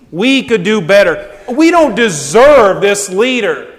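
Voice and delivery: indignant grumbling. Acted. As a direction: grumble like voters who feel cheated. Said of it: "we say to God".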